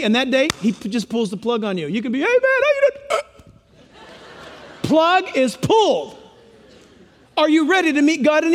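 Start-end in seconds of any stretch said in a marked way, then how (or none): 2.2-3.2 high, breezy, laughing hey man; carefree greeting. Retold or imitated. Preacher mimics a carefree man greeting a friend, laughing and high. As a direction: play the guy who has no idea it's his last day.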